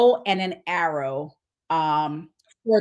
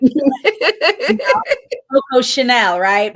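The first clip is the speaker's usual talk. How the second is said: laughing while talking; giggling through the words; 6 semitones higher, 8 dB louder, slower than usual. Real, not acted. Laughing over the nickname.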